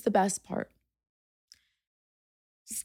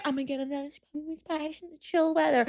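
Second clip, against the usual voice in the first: quiet quoted self; 8 dB quieter, faster than usual. Retold or imitated. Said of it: "after saying".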